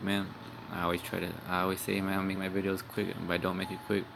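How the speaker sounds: ordinary talk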